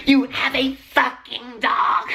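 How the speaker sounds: slow, high, weird mocking voice; imitating the dog guy who taunted her, slow and high. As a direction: mimic the creepy guy, drawn out high. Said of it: "Like, that fucking guy".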